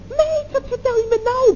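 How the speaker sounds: put-on girlfriend's high-pitched astonishment; astonished exclamation. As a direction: squeal it in disbelief at the news. Reacts to friend's surprise wedding news.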